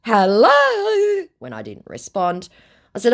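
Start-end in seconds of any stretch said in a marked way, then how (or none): 0.0-1.3 high, slow, syrupy put-on voice; mock scammer voice. Woman reading the scammer's message aloud, in a high mock voice. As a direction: ham up the creepy flattery.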